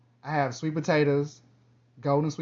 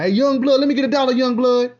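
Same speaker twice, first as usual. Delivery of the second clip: high, loud hustler voice calling out; hammed-up street-corner moocher asking for a dollar; corner panhandler; 10 semitones higher, 9 dB louder than usual. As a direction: hail a young guy like an old man begging on the corner. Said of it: "when a young dude walk by".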